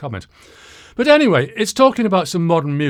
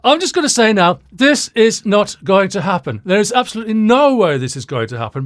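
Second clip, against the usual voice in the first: loud, raised, adamant rebuttal; emphatic refusal; 5 semitones higher, 6 dB louder than usual. Real, not acted. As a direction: put your foot down loudly. Firmly rebuffs the angry comment.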